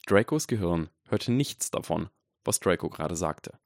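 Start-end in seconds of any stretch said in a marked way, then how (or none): none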